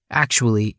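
ordinary delivery, nothing marked

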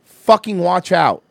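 retold yell. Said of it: "And I was like".

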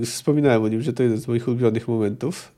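usual speaking voice

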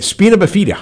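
"Spina bifida" dibaca "spina vefita", salah ucap; mangled pronunciation. Retold or imitated. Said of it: "I said".

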